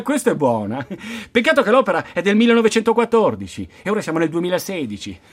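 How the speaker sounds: normal talking voice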